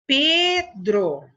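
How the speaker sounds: PEDRO split into pe and dro; emphatic, divided "Pedro"; syllable by syllable. Shown as a demonstration. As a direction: say "Pedro" in two stretched beats. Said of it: "foi junto com a sua vogal".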